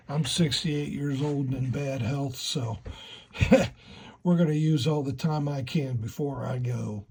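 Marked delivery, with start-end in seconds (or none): 2.8-4.2 short high breathy laugh. Real, not acted. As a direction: laugh it off lightly. Joke about going boom.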